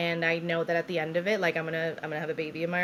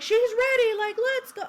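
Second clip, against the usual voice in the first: high, loud imitation of a rallying nurse; rally the team with acted urgency; retold urgency; 6 dB louder than usual. Nurse urgently summons team to deliver.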